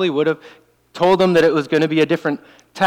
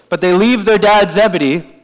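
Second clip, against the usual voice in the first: put-on French Canadian accent; 3 semitones higher, 4 dB louder than usual. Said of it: "French Canadian accent".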